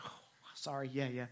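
hushed, embarrassed whispered apology; sheepish whisper. Retold or imitated. Disciples rebuked by the voice from the cloud, sheepish whisper. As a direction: whisper it like scolded kids. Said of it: "And they're like".

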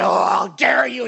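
loud mock outrage; outraged shout. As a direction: bark it back at him. High priest reacts to Jesus.

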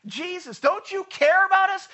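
high, loud panicked cry to Jesus; panicked plea. Retold or imitated. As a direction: cry out in fear over the storm. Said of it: "the disciples said".

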